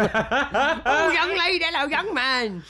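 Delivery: put-on very high, loud cackly old dear; Harry Enfield old dears. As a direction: screech it like a batty old lady. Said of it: "like, the two old dears from, like, Harry Enfield".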